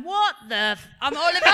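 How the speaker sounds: loud, high mock disbelief; imitated shocked outburst. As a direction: burst out like the stunned son. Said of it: "you just hear Oliver going".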